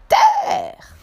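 very high, loud, drawn-out call; lookout's cry. A narrator's reading. Lookout calling to the ship that land is sighted, crying out. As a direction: sing out the sighting from the masthead. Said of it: "la voix de la vigie s'écria".

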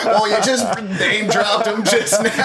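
high-pitched, laughing through the words; laughing tease. Real, not acted. Podcast host ribs a friend about name-dropping, laughing. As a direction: tease a friend while cracking up.

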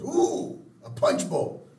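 high, loud mock-swooning coo; delighted exclamation. Wife to Homer on finding a punch bowl, delighted. Marge spots a fancy item.

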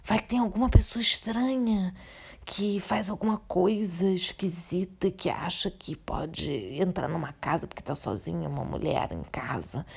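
soft, fading into a whisper. Real, not acted. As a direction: drop to a secret whisper. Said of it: "eu já falei baixo de novo".

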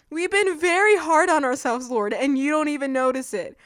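high, loud, indignant whine; whiny complaint. Retold or imitated. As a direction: complain that nobody notices your effort. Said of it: "listen to the tone of that text".

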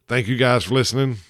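usual speaking voice

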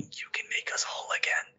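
breathy unvoiced whisper of the marker; eerie whisper. The marker whispering to Isaac, an eerie whisper. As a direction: whisper it like a voice from the alien artifact. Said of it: "just going".

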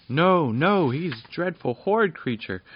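high, loud protesting fairy voices; fairies crying out. Acted. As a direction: fairies shouting her down in alarm. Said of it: "cried the little folk at once".

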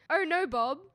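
high, loud call-out. Retold or imitated. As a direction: announce it loudly to the room. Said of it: "She says in a very loud voice".

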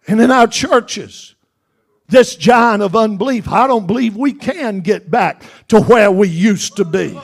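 loud, rising sermon at full force; fiery preaching. Acted. Preacher pleading with his church, loud and fiery. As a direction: preach it hard to the congregation.

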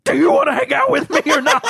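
loud, fast and pushy; loud demanding cat. Retold or imitated. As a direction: demand it loudly like an impatient cat. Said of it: "it's really, like, this loud".

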